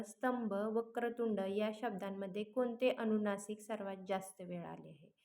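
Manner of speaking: normal talking voice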